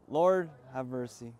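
ordinary delivery

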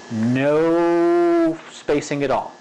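very long, stretched "No"; slow, emphatic "No", held long; drawn-out emphasis. Real, not acted. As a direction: drag out "No" for emphasis. Stretches the word while demonstrating spacing.

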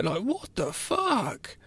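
slow, drawn-out what the fuck, pitched high; stunned disbelief. Real, not acted. Reacting to a shocking movie scene, stunned and slow. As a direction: let the shock stretch every word.

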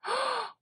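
high, sudden gasp; delighted gasp. Real, not acted. Fan spotting a tiny calendar, delighted gasp.

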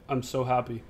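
imitated numb, low-key statement; deadpan. Answering a friend who asked about excitement, deadpan. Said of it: "Like, just deadpan".

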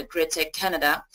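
ordinary talk, nothing marked

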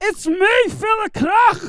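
big brash shout; loudest voice. Acted. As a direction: bellow your own introduction. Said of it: "the loudest voice".

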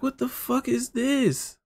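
high-pitched and drawn out slowly, amazed; stunned disbelief. Retold or imitated. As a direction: let the pitch jump up in slow amazement. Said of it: "I was like".

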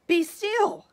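high loud command; boy king commanding the wild things; commanding shout. A narrator's reading. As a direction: freeze the monsters with a shout. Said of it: "Till Max said".

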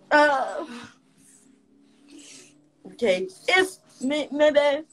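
fighting back sobs; crying. Real, not acted. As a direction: voice breaking into tears. Breaks down mid-story.

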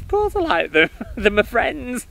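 put-on a boy's cheerful laughing retort; laughing child's reply. To the priest, the boy laughs his reply.

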